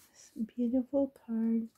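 hushed admiring whisper. Real, not acted. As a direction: breathe it out in awe. Admiring card up close.

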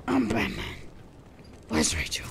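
Batman impression. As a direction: growl it like Batman.

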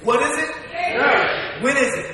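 short, loud questions thrown to the congregation; loud call-and-response. Real, not acted. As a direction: fire it out to get a shout back. Preacher prompting congregation to call back.

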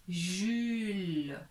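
"Jules" held long, s silent; sounded-out name. Shown as a demonstration. Teacher shows the finished name, drawn out. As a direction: stretch "Jules" slowly. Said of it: "Voilà, Jules".

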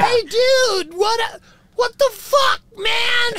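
high, loud rocker drawl; party-rock frontman greeting. Retold or imitated. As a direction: ham up the hair-metal singer. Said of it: "He's like".

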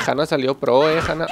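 ordinary talk, nothing marked

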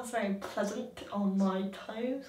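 quiet, numbed by ice water; numb from cold. Real, not acted. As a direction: speak softly, too cold to care. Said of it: "I can't feel it anymore".

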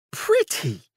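very high startled yelp. A narrator's reading. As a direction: squeal it in disbelief. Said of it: "Charles yelped".

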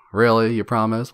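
usual speaking voice

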